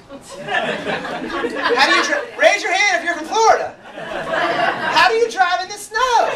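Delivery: high, loud mock outcry; hammed-up, high-pitched shouting; comic exasperated yell.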